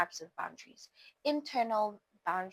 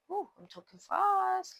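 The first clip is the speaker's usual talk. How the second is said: surprised at herself; 11 semitones higher, 3 dB louder than usual. Real, not acted. Catches herself rushing.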